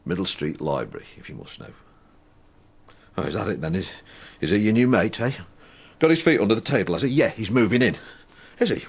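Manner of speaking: playing himself and his anger; reading his therapy script aloud; two-voice dialogue. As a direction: act both sides of a quarrel. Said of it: "your conversation with your anger".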